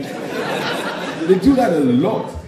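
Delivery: normal talking voice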